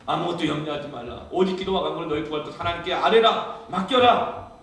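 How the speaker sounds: recitation rising to a loud, high command; building scripture declaration. Acted. Believer reciting the verse when worried, building declaration. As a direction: build the verse up to a shouted last word.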